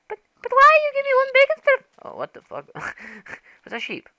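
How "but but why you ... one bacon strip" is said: put-on very high, loud childish whine; squeaky voice complaining about one bacon strip; whiny high voice. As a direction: wail it like a spoilt kid. Begging for bacon.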